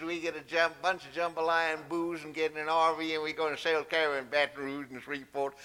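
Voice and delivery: lightly put-on thick Louisiana Southern accent. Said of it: "Tom, what did he just say?".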